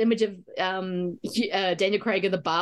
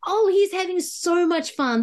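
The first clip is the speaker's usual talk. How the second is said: high, slow, drawn-out fan gush; gushing delight; 9 semitones higher, 3 dB louder, slower than usual. Retold or imitated. Viewer seeing the actor enjoy this role, gushing delight. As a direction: stretch it out with mounting delight. Acted viewer reaction, heightened.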